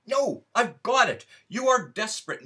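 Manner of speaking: a flash of inspiration, blurted out; sudden eureka. Acted. Narrator hitting on the perfect excuse, excited outburst. Sudden idea in read story.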